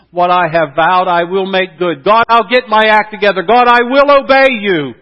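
rising to a loud, high cry of devotion; fervent vow. Acted. Preacher voicing Jonah crying out his vow to God, loud and fervent. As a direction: build from firm promise to a loud, fast pledge.

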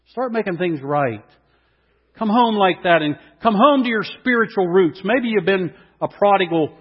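loud, high, soaring sermon reading; rising preacher's proclamation; preaching at full force. Acted. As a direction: lift the scripture up to the back row.

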